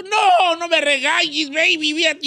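pleading husband. Acted.

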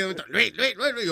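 softly mimicked nagging calls; lightly mocking repeated calling; imitated night-time voice. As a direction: echo the name in a small voice. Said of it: "Y que yo de noche".

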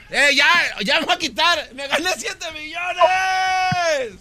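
high, very drawn-out cry of victory; real overjoyed outburst near tears; ecstatic yelling. Real, not acted. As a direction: wail it out with joy, stretching the last word. Yells the win from car.

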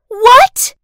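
high, loud startled squeal; shocked. Acted. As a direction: shriek it in shock. Shocked reaction.

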